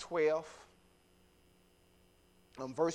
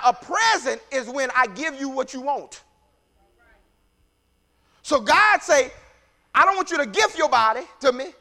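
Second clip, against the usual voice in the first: loud, raised preacher's delivery; impassioned preaching; 8 semitones higher, 9 dB louder than usual. Real, not acted. Preacher telling the congregation what worship is, impassioned preaching. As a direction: preach it out with rising, falling cadence.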